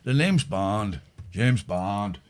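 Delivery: a hint of Pierce Brosnan's Bond. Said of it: "I can do Brosnan".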